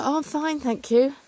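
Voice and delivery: high-pitched mock-polite brush-off; automatic polite reply. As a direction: put on a high, over-polite little voice. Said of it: "I'm certainly guilty of this".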